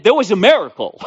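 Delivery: joyful shout of faith; triumphant declaration. Retold or imitated. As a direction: answer the doctors with a bold proclamation. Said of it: "I said".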